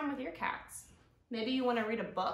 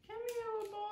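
cooing to a pet; 12 semitones higher, 4 dB quieter, much faster than usual. Real, not acted. Calling the cat.

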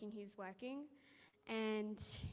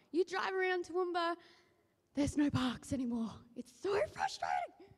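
exasperated; 6 semitones higher, 5 dB louder than usual. Real, not acted. Complaining about parking.

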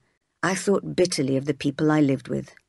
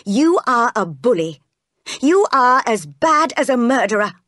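higher, forceful outburst; angry accusation; 5 semitones higher, 4 dB louder than usual. Acted. Jane turning on John, crying out angrily. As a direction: hurl the accusation at the bully. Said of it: "I cried".

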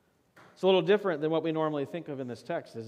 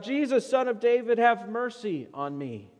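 raised, louder plea, easing at the end; urgent cry; 8 semitones higher, 5 dB louder than usual. Acted. Blind beggar to Jesus over the crowd, urgent cry. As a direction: cry out for mercy, high and pleading. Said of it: "urgently cries out".

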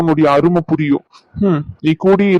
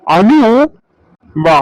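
stern call; 3 dB louder, slower than usual. Acted. Husband orders wife to come.

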